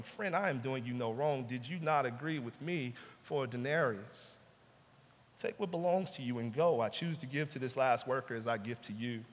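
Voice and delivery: low, soft and steady, kindly firm; calm master. Acted. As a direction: settle the worker down without anger. Master replies to grumbling workers.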